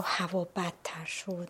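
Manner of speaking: dramatic low whisper on هوا بدتر شد; breathy storytelling whisper; hushed telling. Retold or imitated. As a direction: whisper it like the storm is closing in. Storm worsening on the mountain.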